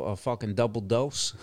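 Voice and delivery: normal talking voice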